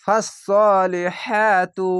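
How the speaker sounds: ফাসসালিহাতু with the ha pushed out on breath; ফাসসালিহাতু, hā sounded with a puff of air; breathy ha. Shown as a demonstration. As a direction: let air escape on হা in ফাসসালিহাতু. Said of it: "এটি হা উচ্চারণ করতে গেলে এরকম বাতাস বেরোবে".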